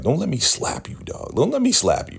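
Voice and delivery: high playful slap threat; mock threat. [name] warning Zane in a mock threat. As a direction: threaten her half-jokingly.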